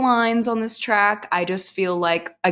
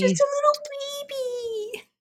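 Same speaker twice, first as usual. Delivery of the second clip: very high, softened coo; mock baby talk; 5 dB quieter than usual. Co-host echoes the teasing, high baby-talk coo. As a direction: squeak it like cooing over an infant.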